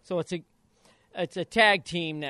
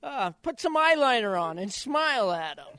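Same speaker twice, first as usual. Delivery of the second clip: high, louder teasing voice; teasing advice; 7 semitones higher, 6 dB louder than usual. Retold or imitated. Father teasing son to wear eyeliner and smile, high mock voice. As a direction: tease him with a grin. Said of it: "And I said".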